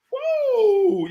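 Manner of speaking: long, high "woo"; high whoop, held and falling; excited whoop. Real, not acted. Host rallying livestream viewers, excited whoop.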